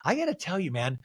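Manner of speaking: ordinary talk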